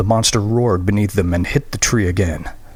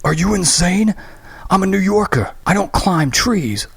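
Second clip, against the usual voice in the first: indignant protest; 10 semitones higher than usual. Acted. Graham refusing to climb.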